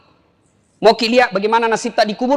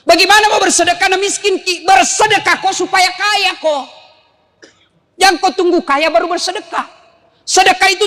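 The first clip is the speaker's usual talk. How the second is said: forceful rebuke; 8 semitones higher than usual. Acted. Preacher rebukes congregation loudly.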